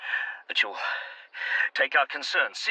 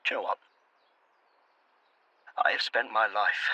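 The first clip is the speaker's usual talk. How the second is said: ordinary talk, nothing marked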